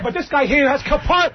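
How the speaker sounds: ordinary talk, nothing marked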